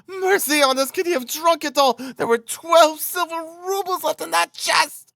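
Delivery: very high hysterical wail; frantic, high-pitched screaming; frenzied cry. A narrator's reading. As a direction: shriek in outrage at the loss. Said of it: "she cried".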